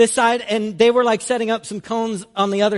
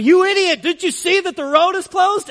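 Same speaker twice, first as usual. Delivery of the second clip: retold yelling; 9 semitones higher than usual. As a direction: yell "you idiot" like an angry worker. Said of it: "yelling at me".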